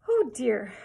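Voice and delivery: loud, dismayed outburst; cry of consternation. A narrator's reading. Mona sees her chopped hair in the mirror, crying out in consternation. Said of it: "cried Mona in consternation".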